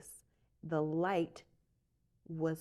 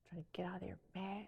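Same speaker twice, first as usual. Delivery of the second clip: whisper; 10 dB quieter, faster than usual. Retold or imitated. Sneaking out past sleeping roommate.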